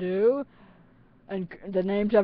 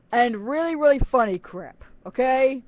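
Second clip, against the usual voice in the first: normal talking voice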